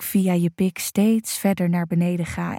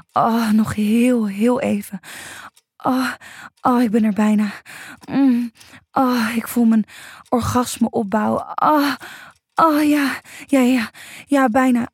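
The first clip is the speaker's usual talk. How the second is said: building moans; 5 semitones higher than usual. Acted. Lover nearing orgasm over her bound partner, building moans. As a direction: let the pleasure build toward climax.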